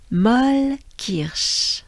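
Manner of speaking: Molle Kirsch slow, stress on molle; slow together. Shown as a demonstration. Teacher saying it together with learners, slow. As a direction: lead the group through Molle Kirsch slowly. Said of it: "Let's do it together".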